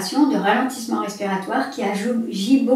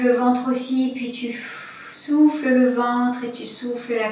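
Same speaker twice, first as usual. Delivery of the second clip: guided breathing. Acted.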